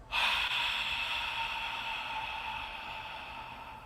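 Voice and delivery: long soft exhale; breathy sigh. Shown as a demonstration. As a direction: let out a long airy sigh. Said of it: "You creating sound with your breath".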